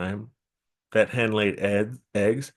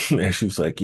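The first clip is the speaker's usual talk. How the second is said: usual speaking voice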